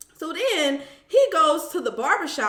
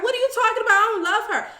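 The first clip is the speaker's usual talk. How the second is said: high, louder mock protest; indignant denial; 10 semitones higher, 5 dB louder, much faster than usual. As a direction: act out his defensive comeback, pitched way up. Acting out his denial in argument.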